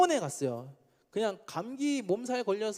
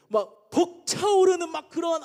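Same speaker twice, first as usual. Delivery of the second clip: higher, louder and slow, swelling with joy; overwhelmed with joy; 7 semitones higher, 5 dB louder, slower than usual. Retold or imitated. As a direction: let the joy burst out slowly. (벅차오르는 기쁨으로 높고 크게)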